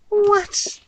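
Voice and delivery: high sharp disbelief; a jolt of real surprise at the price; shocked what. Real, not acted.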